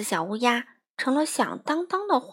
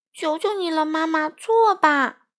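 begging; 6 semitones higher, 5 dB louder than usual. Acted. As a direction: wheedle mom like a small kid. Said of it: "欢仔也在一边央求道".

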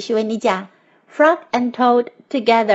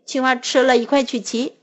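ordinary delivery

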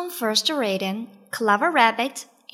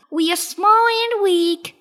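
high, loud little rabbit voice; Clever Rabbit; 9 semitones higher, 6 dB louder than usual. A narrator's reading. Rabbit mocks the drowning lion, high voice. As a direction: throw the taunt back as a small rabbit. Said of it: "said Clever Rabbit".

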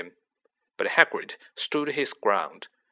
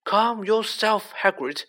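put-on old headmaster's stern command; sharp; 10 semitones higher than usual. A narrator's reading. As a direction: rein him in sharply. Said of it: "said Dumbledore sharply".

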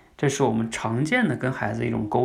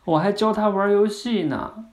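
little daughter; 8 semitones higher, 4 dB louder than usual. Retold or imitated. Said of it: "女儿会说什么".

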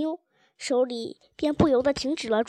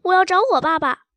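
loud, raised mock wail of a child; petulant crying shout; 4 semitones higher, 7 dB louder than usual. A narrator's reading. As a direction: throw a little tantrum, demanding. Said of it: "便撒赖地哭喊着".

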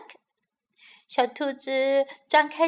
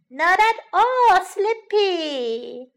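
put-on child protesting; 7 semitones higher, 7 dB louder, much slower than usual. Child insists he's not sleepy.

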